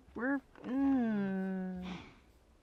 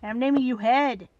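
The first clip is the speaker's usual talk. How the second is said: mock scolding; 8 dB louder than usual. Real, not acted.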